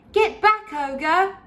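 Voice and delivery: high and loud, ordering the ogre back; heroic shout. Acted. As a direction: yell it like a brave knight. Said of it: "shouted Flynn".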